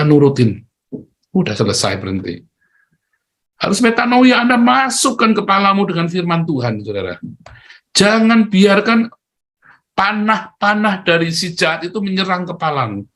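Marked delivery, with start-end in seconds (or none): 3.6-13.0 full-force preaching. Real, not acted. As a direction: preach it out to the church.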